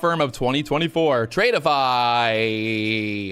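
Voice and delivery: drawn-out, low award-show announcement; announcer reveal. Acted. Host announcing the prop firm winner, drawn out. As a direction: stretch the winner's name like a host.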